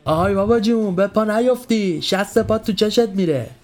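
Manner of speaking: high, teasing youth's holler; acted playful call, pitched well up; jokey shout. A narrator's reading. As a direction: heckle from the back of a crowd. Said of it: "دوباره داد زد".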